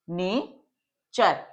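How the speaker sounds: Nature split in two, long gap between ने and चर; slow sounding-out. Shown as a demonstration. Teacher breaking the example word into sounds for students, slowly.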